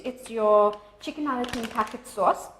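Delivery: ordinary delivery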